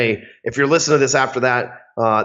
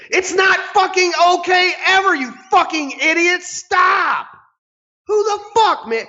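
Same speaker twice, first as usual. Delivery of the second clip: furious shout. Real, not acted. Ranting furiously at dick pic senders.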